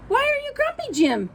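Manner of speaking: high curious question. Acted. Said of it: "asked Marabou".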